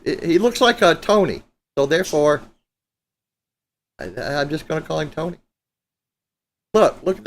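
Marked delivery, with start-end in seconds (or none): none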